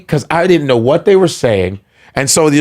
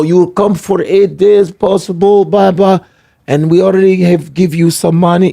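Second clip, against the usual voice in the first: fake Russian accent; 5 semitones higher than usual. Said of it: "that's a great fake Russian".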